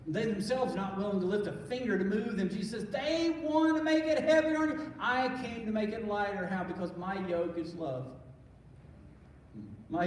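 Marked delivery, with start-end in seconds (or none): 2.9-6.5 emphatic quote. Acted. Jesus quoted, emphatic contrast.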